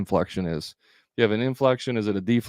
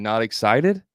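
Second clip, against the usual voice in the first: "excited" tipped up into a question; curious lift at the end of "excited"; rising inflection. Shown as a demonstration. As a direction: mirror "Not excited" back with a rise. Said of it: "you have an inflection".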